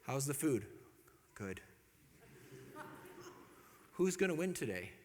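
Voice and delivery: subdued, with long pauses; polite, careful, avoiding any conflict; deferential small talk. Retold or imitated. Introverted family at the dinner table, subdued politeness. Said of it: "very deferential, just no conflict".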